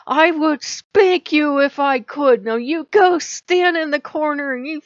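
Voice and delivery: put-on a mother scolding a toddler god, loud. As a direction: lay into them like a naughty toddler. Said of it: "I yelled at them like they were Loki".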